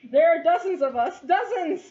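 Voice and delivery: high, loud proud crowing; gloating boast. Acted. Captured cultist boasting to the party, high gloating shout. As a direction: brag about their numbers loudly.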